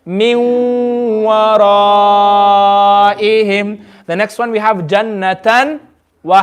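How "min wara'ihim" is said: wara'ihim drawn out very slowly, lips rounded on waw; slow recitation. Shown as a demonstration. Teacher leads class recitation, very slow and drawn out. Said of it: "And you read it with ghunna".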